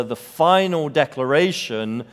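ordinary delivery

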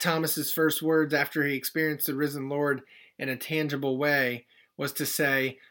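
ordinary talk, nothing marked

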